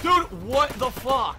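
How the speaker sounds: loud, high, slow protest; real rage at the game; exasperated outburst. Real, not acted. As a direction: drag out each word in angry disbelief. Player killed again.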